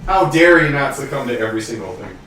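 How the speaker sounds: ordinary delivery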